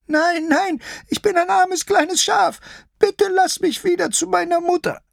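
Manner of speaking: very high, bleating little sheep voice; high-pitched timid lamb, begging; frightened sheep-lion. Acted. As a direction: plead in a tiny high voice, bleating loudly.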